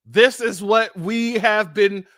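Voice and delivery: normal talking voice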